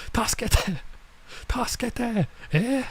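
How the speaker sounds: put-on high-pitched girlish calls for help; mock cry for help. Girl calling neighbors for help, high mock cry. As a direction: pitch way up, a child pleading. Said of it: "She's yelling for help".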